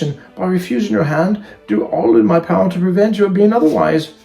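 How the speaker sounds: firm refusal climbing high and loud; spirited refusing heroine. Acted. Young woman turns down silly suitor, rising indignation. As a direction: build to an emphatic, high-pitched rejection.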